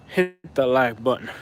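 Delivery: slow country drawl on "like button"; country accent. Pretend. Streamer asking viewers for likes in a slow country accent. Repeats plea in country-style vowel.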